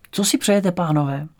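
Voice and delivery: put-on high-pitched, wary old lady; old lady at the door. A narrator's reading. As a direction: ask it high as an old dame answering the door. Old lady greets boys at door.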